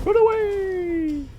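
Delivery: high, slow, hammed-up shriek of retreat; drawn-out falsetto cry to flee; mock panic cry. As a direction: wail it like a cowardly knight fleeing. Fleeing in panic, playing it up.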